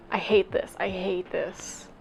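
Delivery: real exasperation, dropping to a near whisper; hushed, exasperated self-grumble; quiet frustration. Real, not acted. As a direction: mutter it to yourself, defeated, slowing down. Reader despairs at own failed accent.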